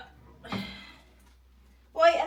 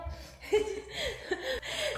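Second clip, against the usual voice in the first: soft high chuckling; long quiet giggle; amused laugh; 9 semitones higher, 8 dB quieter than usual. Real, not acted. Laughing with the child. (mahinang hagikgik)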